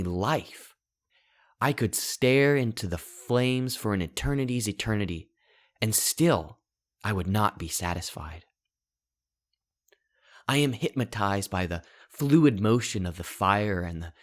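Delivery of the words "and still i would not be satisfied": plain reading voice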